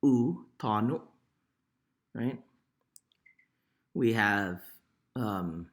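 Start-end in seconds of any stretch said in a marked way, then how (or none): 0.0-1.0 tanu said with a clear oo, not o; oo sound. Shown as a demonstration. Teacher modelling oo in tanu for learners. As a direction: stretch the final vowel of tanu into oo. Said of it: "it sounds like".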